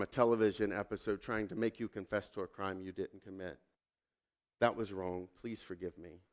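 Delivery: put-on soft and gentle, a father saying sorry to his little girl; quiet, gentle words to make it right; tender apology. A father softly apologizing to his daughter. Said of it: "sat down in front of her and said".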